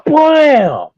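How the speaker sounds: sudden loud high blam; loud cartoon collision yell; impact shout. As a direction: shout the crash as it hits. Crash into wall, retold.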